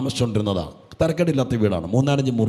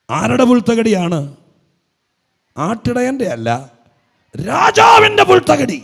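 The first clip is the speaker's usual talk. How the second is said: rising preaching shout; 11 semitones higher, 7 dB louder than usual. Real, not acted. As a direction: voice climbs high and loud on "രാജാവിൻ്റെ പുൽത്തകിടി". Preacher building to climax. (ഉയർന്ന ശബ്ദത്തിൽ ആവേശത്തോടെ വിളിച്ചുപറയുന്ന പ്രസംഗം)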